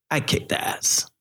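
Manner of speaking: whispered boast. Real, not acted. Proud boast about first class.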